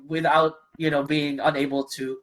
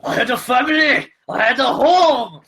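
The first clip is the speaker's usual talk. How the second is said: Irish accent demo; 7 semitones higher, 5 dB louder than usual. Shown as a demonstration. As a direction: show it in a strong Irish accent. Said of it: "and I'll do it for you".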